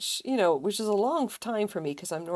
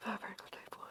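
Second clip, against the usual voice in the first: whispered to self; whispering; 4 semitones higher, 12 dB quieter, faster than usual. Real, not acted. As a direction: whisper the pattern note. Reading pattern to self.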